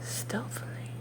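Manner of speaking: barely audible hushed whisper; breathy whisper. A narrator's reading. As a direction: breathe the word out, almost silent. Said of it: "You cannot imagine how stealthily".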